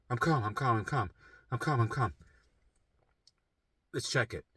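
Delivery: quiet self-soothing mantra. Retold or imitated. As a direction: talk yourself down gently. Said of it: "I'm just like".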